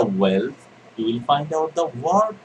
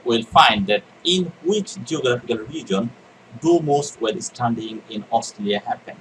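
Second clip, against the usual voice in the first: ordinary talk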